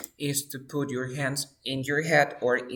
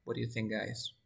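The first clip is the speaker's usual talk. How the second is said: normal talking voice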